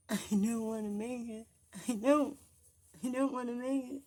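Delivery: pleading through real sobs; crying. Real, not acted. Vlogger breaking down to her viewers, real crying.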